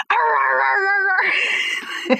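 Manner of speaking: high playful mock growl; imitated puppy growl. Imitating her puppy growling on cue for friends. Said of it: "And he'll go".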